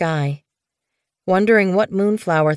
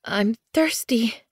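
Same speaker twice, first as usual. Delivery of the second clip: higher, slow, weak whimper; 7 semitones higher, slower than usual. Acted. Snowfur in labour whimpering to the medicine cat. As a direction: whimper it in pain. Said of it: "Snowfur whimpered".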